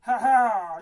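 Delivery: loud, low booming ogre; hearty loud ogre laughing at the frog; gruff ogre. Acted. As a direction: greet the frog like a big bully. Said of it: "lance le petit gros".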